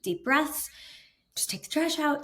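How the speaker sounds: high, strained self-calming; mock-soothing pep talk to herself; forced calm. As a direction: talk yourself down through clenched anger. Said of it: "I'm seething inside, but I'm like".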